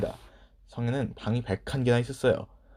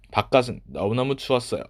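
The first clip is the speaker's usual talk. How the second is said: usual speaking voice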